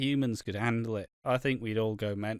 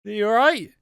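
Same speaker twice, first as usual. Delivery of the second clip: startled mock reaction; 10 semitones higher, 6 dB louder than usual. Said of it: "Just imagine".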